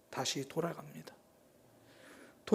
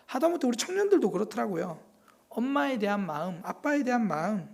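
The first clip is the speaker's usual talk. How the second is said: ordinary talk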